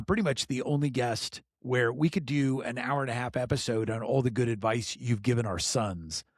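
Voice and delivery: usual speaking voice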